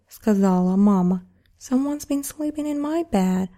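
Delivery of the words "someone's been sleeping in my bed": higher mama bear line. Acted. Mama bear finds her bed slept in, higher voice. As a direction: lift it for mother bear. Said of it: "said the mama bear".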